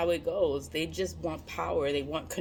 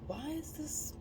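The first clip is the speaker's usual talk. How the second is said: puzzled inner question; 6 semitones higher, 3 dB quieter than usual. Retold or imitated. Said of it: "And it's like".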